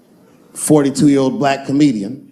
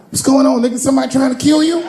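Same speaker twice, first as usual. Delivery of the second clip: high, fast panicked outburst; a father panicking in a rush, high; freaked out; 11 semitones higher, faster than usual. Retold or imitated. A dad alarmed at his son asking for money, panicked. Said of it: "so I freaked out".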